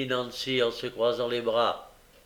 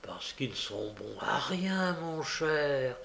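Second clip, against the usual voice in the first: softer, slower, hesitant excuse; a bit embarrassed; 2 semitones higher, 4 dB quieter, slower than usual. A narrator's reading. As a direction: squirm a little as you answer. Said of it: "un peu embarrassé".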